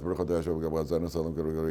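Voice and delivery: hurried, run-together benediction; rushed blessing. Shown as a demonstration. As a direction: rattle it off fast on an even pitch.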